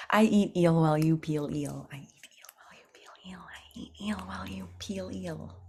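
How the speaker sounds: quick soft whispered repeats; whispered twister. Shown as a demonstration. Coach whispering the eel tongue twister. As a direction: whisper it over and over.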